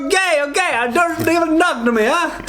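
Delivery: high, hammed-up Montenegrin accent. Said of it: "That's my Montenegrin accent".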